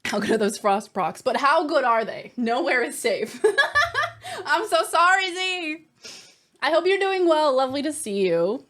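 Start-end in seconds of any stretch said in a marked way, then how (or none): 3.5-4.4 high-pitched giggle. Real, not acted. Laugh after joke.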